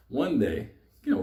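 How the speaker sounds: ordinary talk, nothing marked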